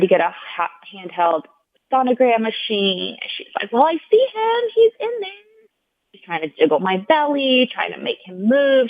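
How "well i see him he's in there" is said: very high, bright imitation of a nurse cooing at the baby; upbeat voice. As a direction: chirp it up high like talking to a baby. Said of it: "talking to him in a really, like, upbeat voice".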